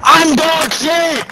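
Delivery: high, drawn-out cry of self-blame; frustrated wail. Real, not acted. Player berating themselves mid-game, frustrated high wail. Player wailing over own failure.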